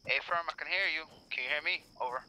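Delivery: crackly military radio. Real, not acted. Radio check between squad leaders.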